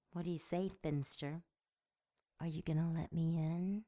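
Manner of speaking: ordinary delivery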